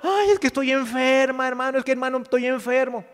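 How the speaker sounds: high-pitched complaining believer, hammed up; mock crying. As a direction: wail about your illness in mock self-pity. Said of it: "Y luego no esté llorando".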